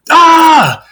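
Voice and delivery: high imitation of mom's scream; high-pitched mock shriek. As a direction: let out a high, drawn-out "Ah!" like a retold scream. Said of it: "my mom screams".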